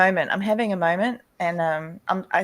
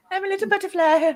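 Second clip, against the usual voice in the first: much higher, playful little-butterfly voice; cutesy butterfly voice; 13 semitones higher than usual. Pretend. Woman calling herself a little butterfly, high cutesy voice. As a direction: squeak it out like a tiny butterfly. Cute self-image after mush talk.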